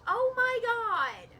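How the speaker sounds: high, loud, drawn-out oh my god; exasperated shriek. Real, not acted. Gamer shrieking as characters die. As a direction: shriek it out in disbelief.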